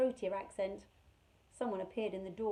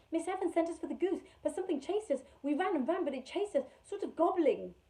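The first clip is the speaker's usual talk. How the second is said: desperate; 8 semitones higher, 5 dB louder than usual. Acted. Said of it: "She said desperately".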